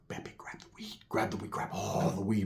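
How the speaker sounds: hushed, secretive whispering; urgent whisper. Retold or imitated. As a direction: whisper so the neighbor can't hear. Secretly urging Pepe beside neighbor.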